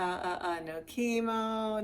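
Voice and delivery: ordinary talk, nothing marked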